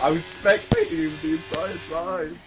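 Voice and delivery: usual speaking voice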